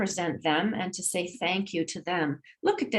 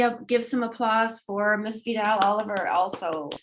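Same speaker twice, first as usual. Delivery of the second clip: usual speaking voice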